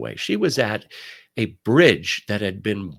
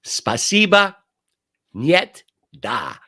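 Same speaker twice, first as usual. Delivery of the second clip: hammed-up Russian words, higher and louder; mock Russian; 5 semitones higher, 5 dB louder, slower than usual. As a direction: toss out a few proud Russian words. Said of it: "That's my Russian".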